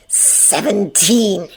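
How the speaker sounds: slow, drawn-out taunt; lightly voiced taunting handler; menacing villain. A narrator's reading. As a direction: stretch the word out menacingly. Villain recites trigger word.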